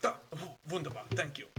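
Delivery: a hint of German, delighted wunderbar; grateful stranger, eager and quick; relieved foreign traveler.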